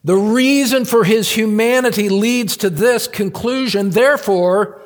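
high, loud pulpit fervor; fervent preaching. Acted. Pastor rousing his congregation with full-force preaching.

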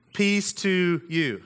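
unhurried, reverent reading of the greeting; a slow quoted blessing; slow solemn scripture reading. Acted. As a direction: read it slowly, letting each word land. Reading Jesus' words from scripture.

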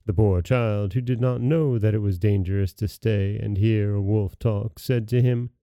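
usual speaking voice

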